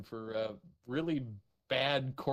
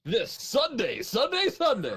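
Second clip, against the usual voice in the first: booming monster truck announcer, higher, louder; 10 semitones higher, 5 dB louder than usual. Acted. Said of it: "monster truck announcer level echo".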